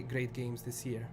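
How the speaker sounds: normal talking voice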